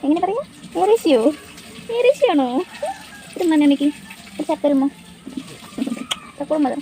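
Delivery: very high, loud cooing. Real, not acted. Mother cooing to her toddler daughter, very high baby talk.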